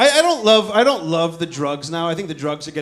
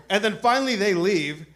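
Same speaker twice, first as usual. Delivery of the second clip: ordinary talk, nothing marked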